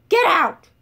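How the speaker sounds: furious shout. Acted. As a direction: throw him out with full force. Chancellor yells.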